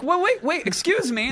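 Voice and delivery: squeaky, put-upon "excuse me"; mock offended squeak; high mock indignation. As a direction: play the flustered victim in a high voice. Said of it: "I'm like".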